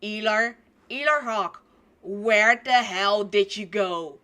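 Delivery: loud, searching call. Acted. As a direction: shout for a missing companion. Companion calling out for Elar.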